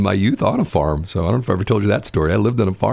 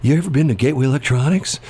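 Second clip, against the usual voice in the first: eager geek; 6 semitones higher than usual. Retold or imitated. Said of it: "and go".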